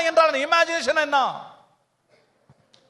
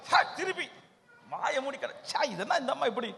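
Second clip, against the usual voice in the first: hushed mutter of revulsion; imitated disgust; 10 dB quieter than usual. Preacher acts a passenger beside someone vomiting, muttered disgust. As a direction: turn away and mutter in disgust.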